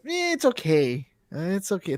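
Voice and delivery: slow, high, lukewarm eh; drawn-out unimpressed sigh of a word; meh shrug. Retold or imitated. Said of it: "I'm like".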